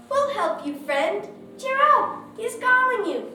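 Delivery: high, loud, eager cheer; encouraging. A narrator's reading. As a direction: urge a friend on brightly. Crowd encourages the blind beggar.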